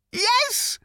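high panicked yelp. Acted. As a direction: squeak it out in alarm. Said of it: "yelped Arthur".

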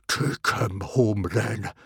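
slow, growled order. A narrator's reading. Stoic giving in to his son Hiccup, growling. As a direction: growl it grudgingly, drawing out the words. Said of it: "growled Stoic to Hiccup".

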